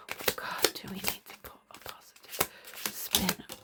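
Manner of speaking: fully unvoiced, hushed question; soft ritual whisper. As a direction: breathe the words to the cards like a secret. Repeats question to cards, whispered.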